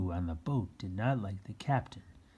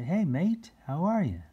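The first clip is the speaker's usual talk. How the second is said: higher, light mock English greeting; 9 semitones higher than usual. Shown as a demonstration. Reader shows how English people greet a friend. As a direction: chirp it like a Londoner.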